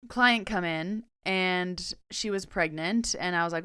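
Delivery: ordinary delivery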